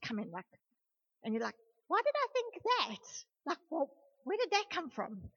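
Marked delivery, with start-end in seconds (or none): 1.9-5.3 acted-out puzzled question, pitched high; mock bewilderment. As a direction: play someone baffled by their own thought. Voices a puzzled listener's inner question.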